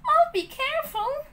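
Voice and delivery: put-on high, earnest child's promise; pleading promise. Pinocchio promising Geppetto, high pleading. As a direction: promise sweetly to win him over. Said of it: "begged Pinocchio".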